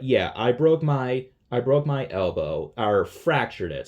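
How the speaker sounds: ordinary delivery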